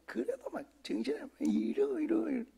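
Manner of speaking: hammed-up high fussing child; mock squirming kid. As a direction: squeal it like an embarrassed boy squirming away. Imitating son's squirming fuss.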